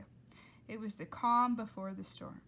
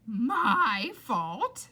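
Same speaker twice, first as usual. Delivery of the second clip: much louder stunned echo; Rita's outraged echo; 2 semitones higher, 12 dB louder than usual. Acted. Rita echoing Flora, much louder and stunned. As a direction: blurt it back in outrage. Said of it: "she said".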